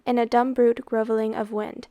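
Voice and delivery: ordinary delivery, nothing marked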